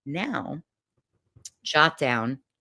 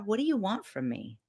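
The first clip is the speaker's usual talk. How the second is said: softly asked, vulnerable plea; quiet, exposed question; a little vulnerable; 2 semitones higher, 7 dB quieter than usual. Acted. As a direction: ask it small and softly. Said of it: "I got a little vulnerable".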